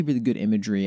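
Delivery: usual speaking voice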